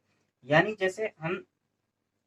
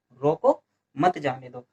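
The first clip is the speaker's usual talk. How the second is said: slow demo, रोको apart, मत जाने दो lower; pause after रोको; 4 semitones lower than usual. Shown as a demonstration. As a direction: separate रोको from मत जाने दो with a short stop. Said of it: "इसमें यहाँ विराम लेंगे". (रोको पर विराम, फिर धीमी गति में मत जाने दो)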